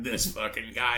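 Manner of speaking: put-on low drunken aside about a stranger; imitated drunk friend. Drunk Cody to his friend about the slot player, low and dismissive. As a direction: drop into a low impression of a drunk buddy.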